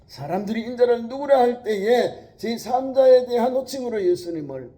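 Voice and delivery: loud, high sermon voice; preaching at full force. Acted. Preacher pressing the congregation hard, full force. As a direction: drive the point home to the church.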